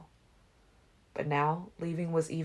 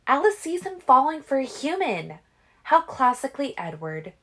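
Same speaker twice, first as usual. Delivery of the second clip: put-on higher, sneering young woman; scornful; 10 semitones higher, 7 dB louder than usual. A narrator's reading. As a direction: sneer at his weakness. Rose mocking Edward.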